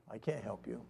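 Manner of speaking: softly, as if only thinking it; unspoken thought. Retold or imitated. Man silently answering the kid, unspoken thought. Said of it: "I didn't tell him the kid".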